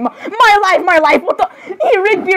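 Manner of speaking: high, half-laughing cry of dismay; flustered outcry. Acted. Acted flustered outcry to Rigby.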